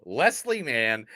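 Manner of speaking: ordinary talk, nothing marked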